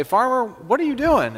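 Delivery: put-on high-pitched kids' question; children calling out a question; curious kids.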